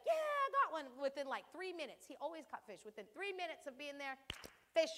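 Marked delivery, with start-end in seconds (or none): 0.1-0.9 high, loud happy shout of a catch; excited catch call. Retold or imitated. Dad calling out a fresh catch, excited and loud. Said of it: "he'd be like".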